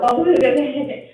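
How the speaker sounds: normal talking voice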